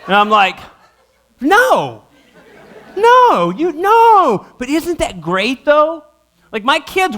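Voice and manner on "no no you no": high, loud, laughing disbelief; shocked dad. Retold or imitated. Father hearing his son is thankful for an Xbox, high laughing disbelief. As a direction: reject the answer in disbelief.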